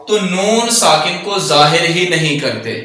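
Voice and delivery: ordinary talk, nothing marked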